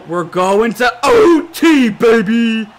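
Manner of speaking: slow, loud triumphant call; excited shout. Real, not acted. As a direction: cheer it out. Celebrating reaching overtime.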